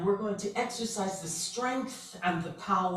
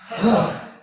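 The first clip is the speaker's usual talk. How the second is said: loud, forceful exhaled huh; powerful huh; 5 semitones higher, 13 dB louder than usual. Shown as a demonstration. Leader exercising the group's voices, forceful huh. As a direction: push the breath out in one burst. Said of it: "with a powerful".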